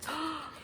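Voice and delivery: quick high excited gasp; mock gasp. Teacher gasps as bear wakes, for the children. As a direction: gasp in pretend surprise. Story suspense gasp for kids.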